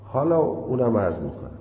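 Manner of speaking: normal talking voice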